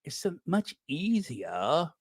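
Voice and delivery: a hint of a sinister posh man; softly mocking the creepy pundit. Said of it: "his little creepy, sinister".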